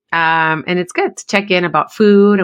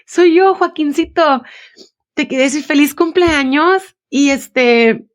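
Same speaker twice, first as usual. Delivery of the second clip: put-on higher sweet child voice, proud of himself; little boy voice; 8 semitones higher than usual. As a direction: play the four-year-old wishing mom happy birthday. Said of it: "y le hace".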